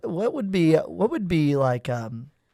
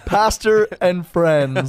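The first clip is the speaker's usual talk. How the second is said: slow echo; much slower than usual. Real, not acted. Echoes correction slowly.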